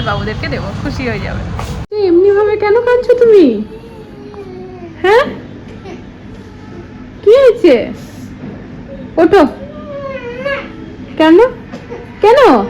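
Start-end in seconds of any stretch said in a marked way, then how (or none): 1.9-12.6 real parental exclaiming, loud and high; loud questioning. Real, not acted. Mother questions her crying children.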